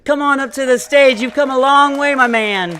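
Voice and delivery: loud, projected MC welcome; rousing stage introduction. Real, not acted. Host calling Martin up to the stage, loud rousing welcome. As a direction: call the act up with full voice.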